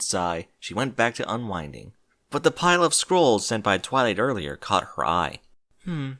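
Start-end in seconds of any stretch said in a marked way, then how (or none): 5.8-6.1 musing Celestia. Acted.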